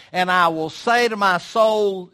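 loud, slow, boastful rich man; acting out the rich fool. Preacher booming the rich fool's boast. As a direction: swell up like a proud landowner. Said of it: "you see how he said it?".

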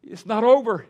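loud and high, urging her to hold on; insistent reassurance. Retold or imitated. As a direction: press the words hard on the grieving mother. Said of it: "And I said, "Penny".

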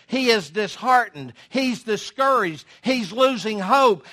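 ordinary talk, nothing marked

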